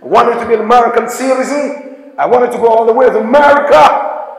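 a lightly put-on American accent, fast; American accent imitation. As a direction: mimic how Americans talk. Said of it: "That's how they speak".

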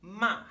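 "Ma" high and loud, dropping sharply; falling fourth tone. Shown as a demonstration. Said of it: "starts high in our register and falls".

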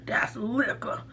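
whispered aside. Real, not acted. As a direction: whisper it like a secret. Whispered aside about the drink.

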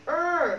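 "Er" drawn out and emphatic; long vowel. Shown as a demonstration. Teacher correcting the mouth shape, a long, emphatic "Er". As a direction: hold "Er" long with a curled-back tongue. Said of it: "lại phải âm ơ".

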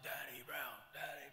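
high mock crowd call, not really shouted; imitated crowd call. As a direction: call the name out like a distant crowd. Crowd calling for missing performer.

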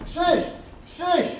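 high call to wake a friend. Acted. Shepherd waking his sleeping friend, calling his name.

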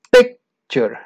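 "picture" split in two, PIC-ture; stress on first syllable. Shown as a demonstration. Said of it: "obviamente escogiste esta, porque es". ("picture" separado por sílabas, acento en la primera)

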